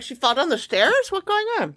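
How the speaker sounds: high-pitched, startled disbelief; mock alarm. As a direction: voice leaps up in alarmed questions. Acts out alarmed misunderstanding.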